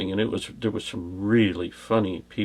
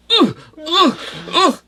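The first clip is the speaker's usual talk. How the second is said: high, loud imitated grunts bouncing on a shoulder; comic jolted ugh sounds; mock grunts; 6 dB louder, slower than usual. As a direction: grunt with each bounce, pitched way up. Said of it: "she went".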